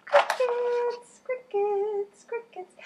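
put-on squeaky cricket-like announcement to kids; playful chirp. Playful high repeated answer.

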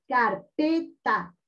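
"carpeta" in three beats, car-PE-ta; syllable by syllable. Shown as a demonstration. Teacher asks where force falls, "carpeta" split by syllable. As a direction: separate each syllable of "carpeta". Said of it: "dónde tiene la mayor fuerza de voz".

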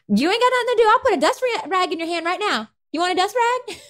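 very high-pitched Southern mama scolding. Acted. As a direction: holler it like a bossy Southern mama. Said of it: "Southern Mama".

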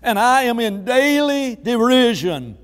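loud, high-pitched preacher voicing a prophet's complaint; impassioned preaching. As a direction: preach the prophet's protest at full force.

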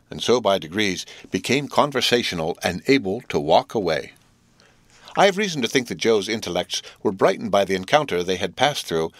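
ordinary delivery

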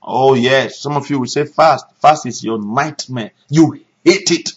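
ordinary talk

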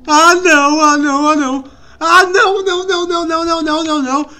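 loud, high burst of repeated protests; frightened cry, easing slightly at the end; panicked refusal. Real, not acted. Panics at threat in game.

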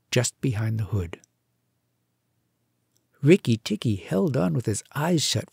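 ordinary delivery

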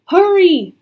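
high, loud urging shout; urgent call. A narrator's reading. Scholar urges them on.

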